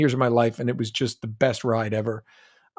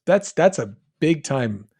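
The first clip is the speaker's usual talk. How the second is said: usual speaking voice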